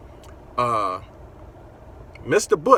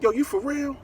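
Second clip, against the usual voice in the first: incredulous. Retold or imitated. Friends laughing on the phone.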